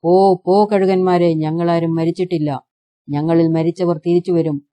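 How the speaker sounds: ordinary delivery, nothing marked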